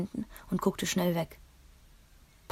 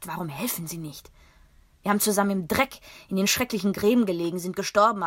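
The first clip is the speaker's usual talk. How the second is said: raised, bitter voice, louder; furious at his old comrades; angry outburst; 2 semitones higher, 4 dB louder than usual. Acted. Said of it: "Ich hatte Papi noch nie so laut werden hören". (wütend, laut und verbittert)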